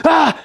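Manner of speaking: high, loud mock startled cry; the sun spooking the paint with a yelp; startled shout. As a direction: jump out with a sudden yelp. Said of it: "I did it not aggressively".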